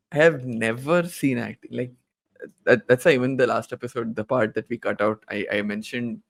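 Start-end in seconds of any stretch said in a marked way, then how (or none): none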